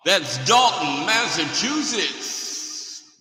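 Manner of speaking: grand slow announcement; drawn-out place name. Acted. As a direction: savour the town name like an announcer. Announcing party location, drawn out.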